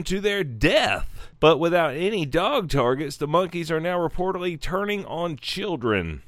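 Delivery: deliberate article read-out in an announcer style; news read. Acted. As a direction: read the report out with dramatic emphasis. Reads article aloud.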